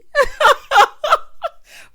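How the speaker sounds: a loud, high burst of laughter; shrieking laugh at the drama. Real, not acted. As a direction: cackle out loud at the clip. Reacts to retold argument.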